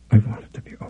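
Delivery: soft voiceless whisper; frightened whisper. Acted. Captive Lydia begging for it to end: frightened whisper. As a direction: breathe the wish out, afraid to be heard.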